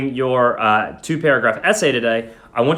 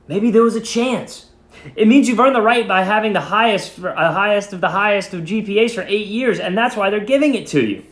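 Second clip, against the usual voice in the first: a lightly voiced young girl, higher, answering quickly; 7 semitones higher than usual. A narrator's reading. Martha answering Grandpa quickly, a lightly voiced higher girl. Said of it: "I answered quickly".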